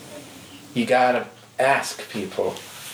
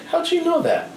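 shocked high squeak. Retold or imitated. Girl shocked her back known.

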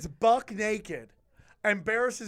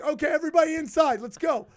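high, loud, fast herding order; rallying call; 9 semitones higher, 6 dB louder than usual. Retold or imitated. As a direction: hustle everyone inside. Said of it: "So I'm like".

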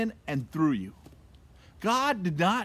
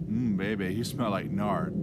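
low, lightly crooned pickup line, a joke; mock seductive; 8 semitones lower than usual. Preacher jokes to congregation with a lightly mock seductive voice. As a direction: tease it like a cheesy flirt.

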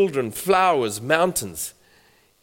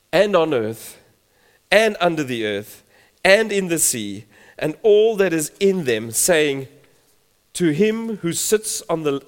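louder, proclaiming Bible reading; scripture reading; 5 dB louder than usual. Acted. Preacher reading Revelation five to the congregation, proclaiming. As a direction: declaim the verse to the church. Reads Revelation aloud with force.

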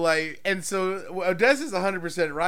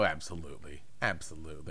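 low knowing; 11 semitones lower, 12 dB quieter, faster than usual. Real, not acted. Low sly agreement.

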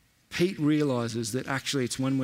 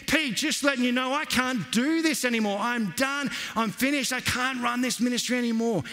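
insistent repeat; 9 semitones higher than usual. Retold or imitated. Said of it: "So I reiterated again".

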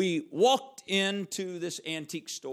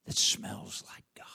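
slow hushed whisper; 6 dB quieter than usual. Acted. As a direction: whisper it like a secret. Preacher drops to hush.

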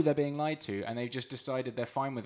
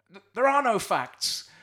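mocking Farage quote; 9 semitones higher, 8 dB louder, slower than usual. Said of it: "and he just said".

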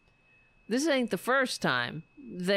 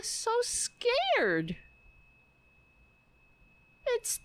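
mock scared voice; 12 semitones higher than usual. Mocking the politician, high sarcastic fake fear. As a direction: sneer at her fear in a high voice.

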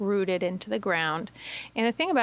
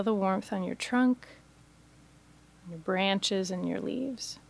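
guided meditation; 4 dB quieter than usual. Acted. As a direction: guide listeners gently into stillness, phrase by phrase. Leads a guided imagery meditation.

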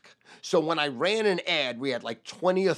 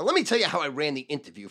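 usual speaking voice

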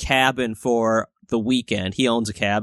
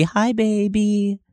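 put-on sweet baby talk; 8 semitones higher than usual. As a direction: coo to the baby after the yelling. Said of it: "goes back to the baby".

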